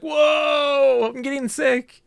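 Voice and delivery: high, drawn-out "whoa" of a woozy crowd; mock dizzy wail. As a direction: imitate queasy players crying out in a high voice. Acts out the spinning crowd's reaction.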